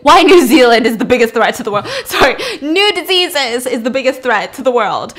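loud, laughing blurt. Retold or imitated. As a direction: belt out the fumbled quote, amused. Blurts slip, laughs, corrects it.

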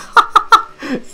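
sudden high cackle at the crash; high burst of laughter. Real, not acted.

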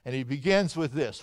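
usual speaking voice

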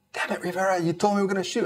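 high, exasperated mock outburst; freaking out. Officer blaming Rivera when the light drops, high panicky outburst. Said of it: "Mister Lundquist freaking out".

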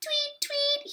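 very high, soft birdlike squeak; teasing tweet. A narrator's reading. Brother teases dancing sister, squeaky tweeting. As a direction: chirp it in a tiny falsetto to tease. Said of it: "he teased".

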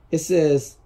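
usual speaking voice

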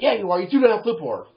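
loud, high contradiction; insistent accusation. Acted. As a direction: insist over the denial. Acts argument with manager.

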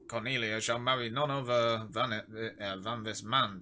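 ordinary talk, nothing marked

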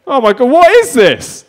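high, very loud, astonished bellow; Henry the eighth. Acted. Old king on his first escalator, astonished shout. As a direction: boom it out in drawn-out disbelief. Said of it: "This is Henry the eighth, but he's on an escalator".

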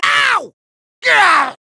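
sharp high cry of pain; cartoon "ow" and grunt; pained yelps. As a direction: yelp mid-sentence as if struck. Hurt while being untied.

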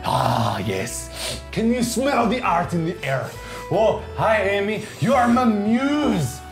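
hammed-up French art host accent; fake French accent. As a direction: play a pompous French artist, thick mock accent. Hosts in a put-on French accent.

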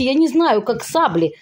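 ordinary talk

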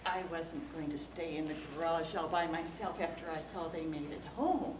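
ordinary delivery, nothing marked

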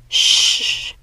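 voiceless shushing hiss to silence her sisters; sharp hush. A narrator's reading. Hazel silencing her sisters, a sharp whispered hush. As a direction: hush them fast, barely breathed. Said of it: "cautioning her sisters to be quiet".

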